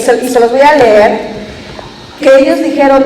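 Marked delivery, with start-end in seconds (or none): none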